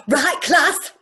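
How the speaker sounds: high, loud sing-song teacher; Sue Pollard. Retold or imitated. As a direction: trill it like a giddy teacher. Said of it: "Dead ringer for Heidi High's Sue Pollard".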